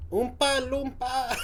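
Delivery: high-pitched imitation Geordie; Geordie accent attempt. Viewer copying the comedian, in an attempted Geordie accent. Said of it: "I fucked Oompa Loompa".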